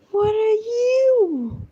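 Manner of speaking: high-pitched, stretched-out wail of bafflement; slow, sing-out high "you"; high drawn-out question. Real, not acted. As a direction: draw it out long and high. Puzzled cooing at the screen.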